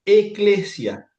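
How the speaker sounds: eclesia drawn out slowly, high and loud; Greek word. Shown as a demonstration. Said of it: "la palabra es eclesia".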